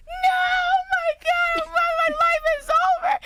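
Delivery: high, hammed-up wailing half-sob; mock crying. Woken in the night by bad news, retelling a mock wail that his life is over. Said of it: "I'm crying".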